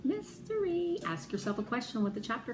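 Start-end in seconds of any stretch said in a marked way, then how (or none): 0.0-1.0 1 mysterious. Shown as a demonstration. Teacher teasing a mystery.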